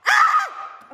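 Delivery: very high thrilled shriek; excited squeal. Real, not acted. Opening a long-awaited package, high squeal. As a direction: let out a squeal.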